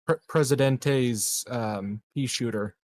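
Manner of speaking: normal talking voice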